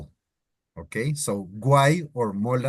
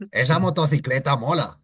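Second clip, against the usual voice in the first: Castilian Spanish accent; Spain Spanish accent; 2 semitones higher, faster than usual. Shown as a demonstration. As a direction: sound like someone from Spain. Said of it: "That's how they sound".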